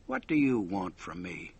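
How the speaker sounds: a dark, hushed demand; low cold medium voice. A narrator's reading. Medium to her visitor, low and guarded. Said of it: "and she spoke".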